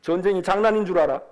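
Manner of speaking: ordinary talk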